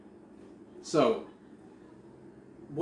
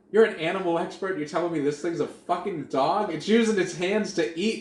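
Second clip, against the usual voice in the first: loud, raised-pitch comic disbelief; mock indignant; 6 semitones higher, 8 dB louder than usual. Viewer arguing with the TV animal expert, loud mock indignation. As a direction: argue back in exasperated, hammed-up outrage.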